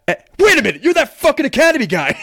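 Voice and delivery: high, loud, recognizing outburst; imitated sergeant shout. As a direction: bark it as the sergeant who just caught him.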